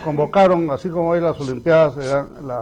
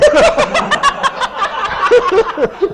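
very high, loud laughing fit; high laughter; 5 dB louder than usual. Real, not acted. Friends laughing at the firing-squad joke, very high laughter. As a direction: crack up at the punchline.

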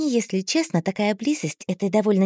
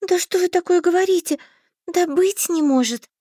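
put-on high, frightened naive girl; flustered girl; 9 semitones higher than usual. A narrator's reading. Heroine flustered.

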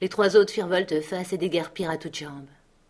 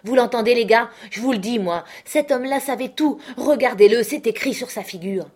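loud, higher accusing outcry; 4 semitones higher, 6 dB louder than usual. Acted. Mary loudly accusing Silver before the crew. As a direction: turn the men against him.

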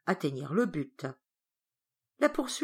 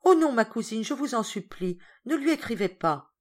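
higher-pitched, urgent begging; pleading; 6 semitones higher, 5 dB louder than usual. Acted. A child begging a cousin not to write, pleading. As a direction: beg anxiously, starting loud and high.

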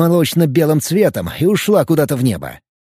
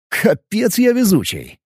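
amazed exclamation; 8 semitones higher than usual. Acted. Hero marvels at narrow escape.

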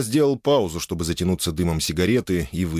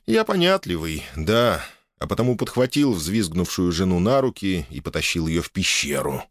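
ordinary delivery, nothing marked

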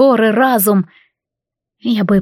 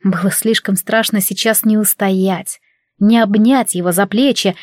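usual speaking voice

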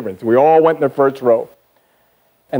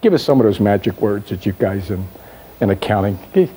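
demanding customer; 6 semitones lower than usual. Retold or imitated. Customer asking the accountant for buzzwords, lower pushy tone. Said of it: "Customer says to you".

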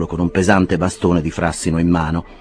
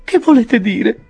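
much higher alarmed lady; frightened question; 4 dB louder, slower than usual. A narrator's reading. Mrs Bardell alarmed at the clerk, high frightened voice. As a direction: ask with pounding heart. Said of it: "col cuore che le batteva".

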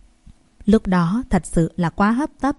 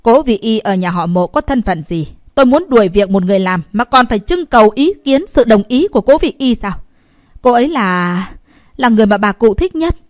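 cold, louder rebuke from a woman in charge; icy authority, pushed louder; 2 semitones higher, 6 dB louder than usual. A narrator's reading. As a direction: put them coldly in their place, raising the voice. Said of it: "lại lạnh giọng nói".

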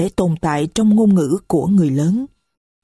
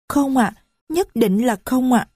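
put-on higher, small voice of a young girl; little girl's voice; 6 semitones higher than usual. Little Marie complains to the stranger, high child voice. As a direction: speak as a little child who is hurt.

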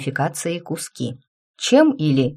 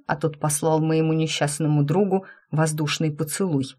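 ordinary delivery

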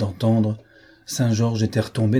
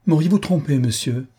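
accusing question; 6 semitones higher, 3 dB louder than usual. A narrator's reading. Marquise accuses the chevalier.

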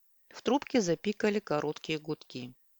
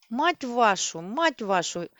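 louder, repeated cursing yell; shouting; 4 semitones higher, 4 dB louder, slower than usual. A narrator's reading. Rita curses the strange device again, shouting. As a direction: cry it out, beside herself. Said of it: "Рита кричала".

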